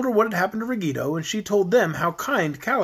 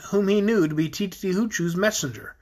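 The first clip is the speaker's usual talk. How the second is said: ordinary delivery, nothing marked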